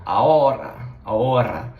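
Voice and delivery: ahora in Spanish, silent h, tapped r. Shown as a demonstration. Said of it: "you'll say now".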